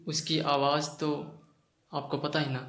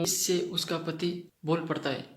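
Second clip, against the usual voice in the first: usual speaking voice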